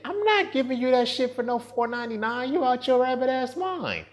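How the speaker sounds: high-pitched, incredulous outburst; indignant refusal. Acted. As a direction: refuse like it's an outrageous ask. Indignant refusal to imagined customer.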